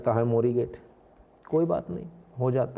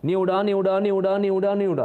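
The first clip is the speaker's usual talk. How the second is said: loud, higher calling out न्योडा न्योडा; bus conductor calling; 7 semitones higher, 4 dB louder than usual. Retold or imitated. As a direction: shout न्योडा like a Delhi bus tout. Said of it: "वो चिल्ला रहे होते हैं".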